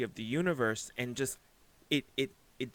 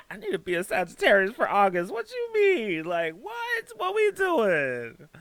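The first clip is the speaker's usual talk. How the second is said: much higher and louder, squealing with excitement; excited squeal; 10 semitones higher, 8 dB louder than usual. Real, not acted. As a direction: squeal it out in delighted disbelief.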